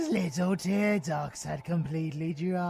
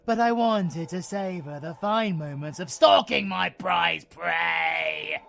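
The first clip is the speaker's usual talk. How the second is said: loud, fast, higher, hungry cry; gloating shout; 4 semitones higher, 8 dB louder than usual. Acted. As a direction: snarl out your hunger.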